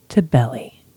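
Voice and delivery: breathy whispered reading; hushed calm read. Shown as a demonstration. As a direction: whisper it gently as a calming guide. Reading breathing quote softly.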